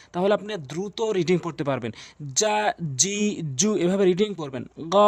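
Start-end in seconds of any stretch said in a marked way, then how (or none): 2.3-3.8 drawn-out vowels. Shown as a demonstration. Teacher demonstrating long vowels to viewers, slow and stretched. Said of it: "এক আলিফ টান অবশ্যই ঠিক রাখবেন".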